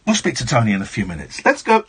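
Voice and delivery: usual speaking voice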